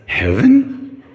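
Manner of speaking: can't believe what you heard; incredulous. Retold or imitated. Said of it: "I said".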